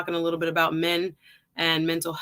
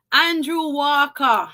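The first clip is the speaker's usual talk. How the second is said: drawn-out, higher, louder Jamaican accent; 5 semitones higher, 5 dB louder, much slower than usual. Real, not acted. Host hailing Andrew Walker in the chat, Jamaican accent. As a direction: let a Jamaican accent burst out, slowly. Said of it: "my little Jamaican accent sometimes come out".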